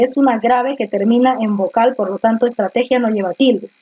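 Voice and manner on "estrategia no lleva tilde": ordinary talk, nothing marked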